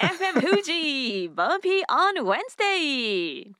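high, slow, announcer-bright opener; radio show opener. Shown as a demonstration. Asked how she opens her show, high slow radio announcer call. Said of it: "how do you start your radio show".

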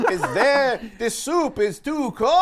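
high, loud complaining kvetch; Yiddish accent. Pretend. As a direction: whine about the soup. Yiddish bit catchphrase.

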